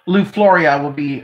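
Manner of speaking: ordinary talk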